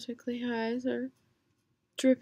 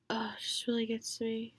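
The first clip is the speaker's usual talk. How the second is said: whisper. Real, not acted.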